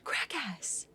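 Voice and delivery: hushed unvoiced whisper. Real, not acted. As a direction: whisper it softly. Whispered emote name.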